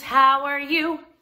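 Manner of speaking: plain reading voice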